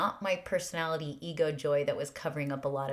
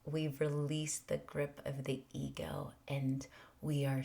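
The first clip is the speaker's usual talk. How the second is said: hushed whisper; 2 semitones lower, 8 dB quieter than usual. Real, not acted. Hushed spiritual emphasis.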